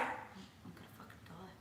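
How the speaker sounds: retold whispered dread at a huge drink; mock whispered panic. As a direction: whisper it as if doomed. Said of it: "And I was like".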